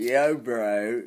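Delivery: loud mock party-goer call; guys on pills. Promoter mocking Ibiza guys on pills, loud imitation. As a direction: imitate a pilled-up lad calling out loudly. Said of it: "guys on pills going".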